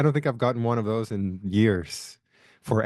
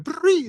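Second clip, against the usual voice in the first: high squeaky tic yelp; tic noise; 6 dB louder than usual. Retold or imitated. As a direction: blurt out a fake tic. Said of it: "They were going like".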